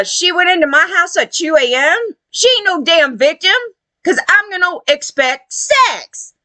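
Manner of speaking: high-pitched mock entitlement; mocking, indignant impression; mimicked defiant men. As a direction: imitate a man scoffing at the accusation. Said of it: "what they're saying is".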